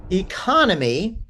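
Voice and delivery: normal talking voice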